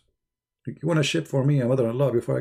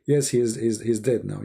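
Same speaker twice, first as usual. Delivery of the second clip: ordinary talk, nothing marked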